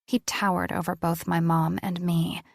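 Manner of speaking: ordinary delivery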